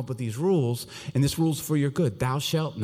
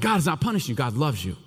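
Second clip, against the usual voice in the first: ordinary talk, nothing marked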